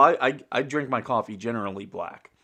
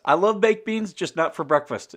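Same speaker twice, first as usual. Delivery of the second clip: excited; 8 semitones higher, 6 dB louder than usual. Real, not acted. Excited beans defence.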